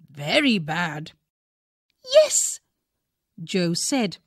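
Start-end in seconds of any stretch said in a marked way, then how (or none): 2.1-2.6 put-on high excited child's yes; a small girl urging the story on; eager little girl. A narrator's reading. Jo eagerly agreeing with her father's story.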